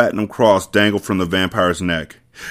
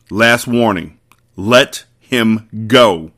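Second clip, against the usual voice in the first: slow final warning; much slower than usual. Acted.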